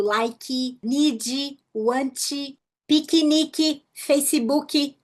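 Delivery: an extra 'ee' after each final consonant; mock learner English, like-ee, need-ee, want-ee; Brazilian added final vowel. Shown as a demonstration. Teacher showing the Portuguese habit, vowel added after final consonants. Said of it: "completar a consoante com uma vogal no final".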